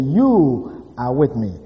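YOU drawn out long, then a slow "are with me"; stress on YOU. Shown as a demonstration. Preacher quoting David's words to congregation, stress on YOU. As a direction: lean hard and long on the word YOU. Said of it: "we try to emphasize the word".

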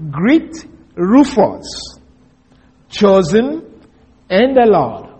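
slow, Greet Rufus, chosen drawn out; slow scripture reading. Shown as a demonstration. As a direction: read the verse slowly, weighing chosen. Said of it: "the word chosen there is the same word".

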